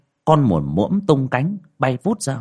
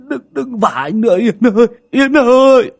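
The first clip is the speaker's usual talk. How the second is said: much higher and louder, rising into a desperate wail; terrified begging; 13 semitones higher, 8 dB louder than usual. Acted. Man slapped by his dead sister's ghost, wailing for mercy. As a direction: beg the ghost to stop, panicking.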